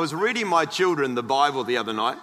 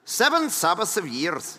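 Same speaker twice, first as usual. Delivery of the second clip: put-on Scottish brogue; comic American attempt at Scottish; Scottish accent. Shown as a demonstration. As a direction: read it like an amateur Scot. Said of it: "I can do this in a Scottish accent".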